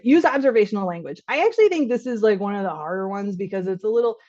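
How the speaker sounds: ordinary talk, nothing marked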